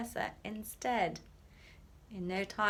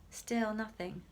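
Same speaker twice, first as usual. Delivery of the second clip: plain reading voice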